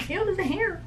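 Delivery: sudden squeamish squeal at a hair; grossed-out ew. Real, not acted. Spotting a hair on her mirror mid-sentence, grossed out.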